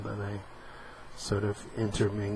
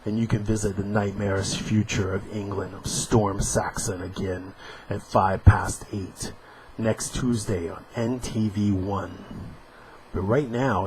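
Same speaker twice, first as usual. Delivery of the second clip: usual speaking voice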